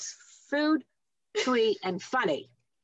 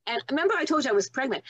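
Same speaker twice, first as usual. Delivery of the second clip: ordinary delivery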